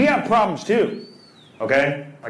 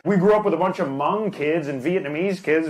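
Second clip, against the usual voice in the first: ordinary talk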